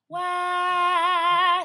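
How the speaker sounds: high, airy sung "wah"; an airier, wider "wah". Shown as a demonstration. Coach shows the right airy middle-voice wah. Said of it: "It's an airier sound".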